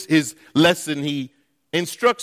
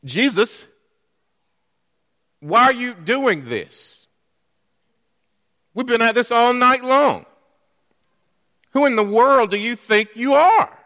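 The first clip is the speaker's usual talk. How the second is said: frustrated outburst; 7 semitones higher than usual. Retold or imitated. Peter protesting to Jesus after a fruitless night, exasperated. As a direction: let the fisherman's patience snap. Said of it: "I can imagine him saying this".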